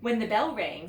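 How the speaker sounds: ordinary delivery, nothing marked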